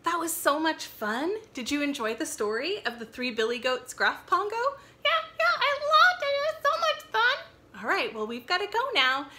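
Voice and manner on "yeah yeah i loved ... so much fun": very high squeaky puppet voice. Acted. Librarian voicing puppet Pongo for kids, high and squeaky. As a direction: chirp it like an excited puppet.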